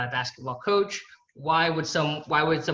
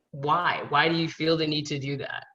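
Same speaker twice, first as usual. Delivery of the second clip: ordinary delivery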